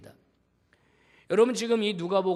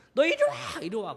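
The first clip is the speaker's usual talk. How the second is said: put-on high, loud furious mom calling the child; mock angry mother; 10 semitones higher, 4 dB louder than usual. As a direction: yank the kid back in mock rage. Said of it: "우리 엄마들은 어떻게 하죠?". (화난 엄마 흉내, 높고 큰 소리)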